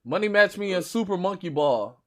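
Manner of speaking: ordinary talk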